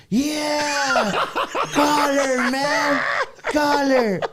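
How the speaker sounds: high, loud laughing shout; crowd cheering. Retold or imitated. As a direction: egg him on like a rowdy crowd. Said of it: "And everybody's like".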